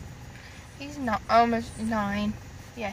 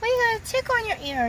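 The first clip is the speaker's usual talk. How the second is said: normal talking voice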